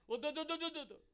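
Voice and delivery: high, frantic mock child's no no no; mock pleading student. Exam student pleading with God, high mock panic. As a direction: play a panicky student refusing.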